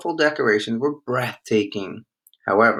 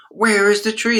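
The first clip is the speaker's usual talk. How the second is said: put-on high, louder dismayed kids; children crying out; 10 semitones higher, 5 dB louder than usual. A narrator's reading. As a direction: call out like disappointed children. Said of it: "they cried".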